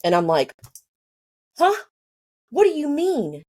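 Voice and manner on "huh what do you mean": high-pitched, baffled "come again?"; retold shock, voice leaping up; stunned disbelief. Told he's going into a coma, stunned and high.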